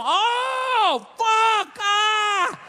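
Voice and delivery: high loud hammy wail of realization; mock anguished cry. Wife beater realizes, mock cry.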